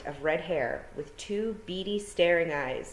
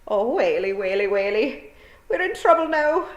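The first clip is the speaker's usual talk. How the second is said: high, loud wee-man wail; panicked Scots wail; 6 semitones higher, 7 dB louder than usual. A narrator's reading. Wee men fearing the hag's reckoning, high loud Scots wail.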